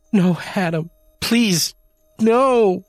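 high, rising panicked plea; desperate begging. A narrator's reading. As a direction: beg him, voice climbing. Janie begging Adam.

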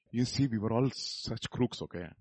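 ordinary talk, nothing marked

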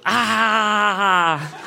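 high drawn-out story scream. A narrator's reading. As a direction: scream as you fall through a portal. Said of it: "I scream".

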